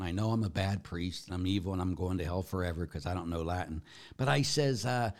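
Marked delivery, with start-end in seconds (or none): 0.0-3.8 quick, low, soft self-accusation; hurried, sing-through list of mock guilt; mock confession. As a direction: rattle off your sins under the questioner's judgment. Mock confession to a Latin requester.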